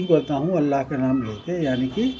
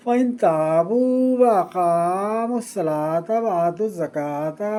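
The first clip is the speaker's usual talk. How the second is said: Quran recitation; 4 semitones higher than usual. Shown as a demonstration. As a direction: intone each phrase with a drawn, sung cadence. Teacher recites Quranic verses.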